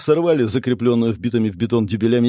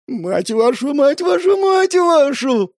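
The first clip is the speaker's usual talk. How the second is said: much higher, rising into a drawn-out wail; howling curse; 3 dB louder, slower than usual. A narrator's reading. Man hearing his name on the wanted list, howling. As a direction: howl each repeat higher, stretch the last. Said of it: "взвыл Игорь".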